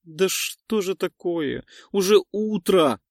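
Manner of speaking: slow, then a desperate loud cry; inner cry. Acted. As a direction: cry out in despair. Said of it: "кричит её душа".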